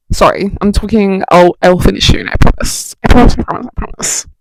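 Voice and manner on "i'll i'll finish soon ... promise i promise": ordinary talk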